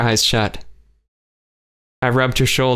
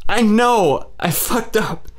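high, cracking cry of guilt; much higher, then dropping; despairing outburst; 10 semitones higher, slower than usual. A narrator's reading. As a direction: blurt it out in despair. Kay's outburst.

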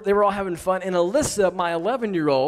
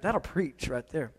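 low, slow, soft mutter under the breath; 5 semitones lower, 9 dB quieter than usual. Retold or imitated. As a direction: mutter it to yourself, half-amused.

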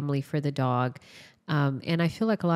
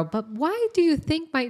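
put-on much higher, pleading child's voice; high little-girl voice asking the vet why; imitating a little girl; 11 semitones higher than usual. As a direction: squeak it out like a puzzled kid. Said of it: "I just hear the little girl".

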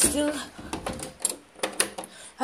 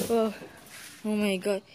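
ordinary talk, nothing marked

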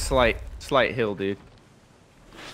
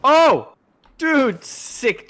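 excited; 11 semitones higher, much slower than usual. Real, not acted. Reacts to a trick.

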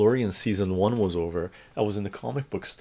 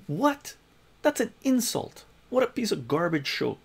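indignant disbelief; 11 semitones higher than usual. Retold or imitated. He retells his own reaction to the show, high mock outrage. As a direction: play the offended fan in disbelief. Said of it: "I go".

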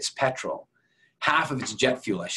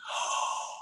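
soft breathless gasp of shock; 9 dB quieter than usual. Retold or imitated. Hearing the dog was debarked, a shocked gasp. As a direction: gasp in disbelief. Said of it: "I was shocked".

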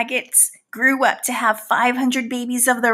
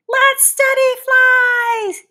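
high, slow, loud cheer; rally the class like a cheerleader; rallying cry; 12 semitones higher, 4 dB louder, slower than usual. Acted. Fly urging kids to study flies, big high cheer.

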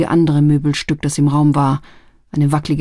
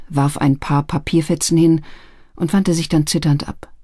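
usual speaking voice

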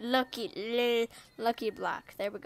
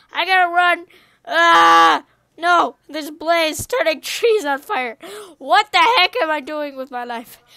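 loud, raised, frantic yelling; comic panic; 5 semitones higher, 13 dB louder than usual. Pretend. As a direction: yell while fleeing monsters. Fleeing spiders and a blaze.

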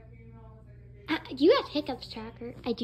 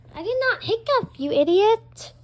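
loud, irritated retort; indignant denial; 5 semitones higher, 7 dB louder than usual. Acted. Tracker denying the hiccup, loud indignant retort. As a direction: deny it loudly and insult them.

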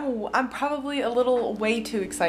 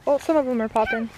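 normal talking voice

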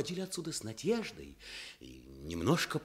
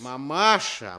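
firm call; 3 semitones lower, 9 dB louder, slower than usual. Acted. Calms the old woman.

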